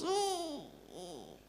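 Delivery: imitated child's soft, high "ooh"; high hugging coo. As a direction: squeal softly as a child mid-hug. Imitates son giving a hug.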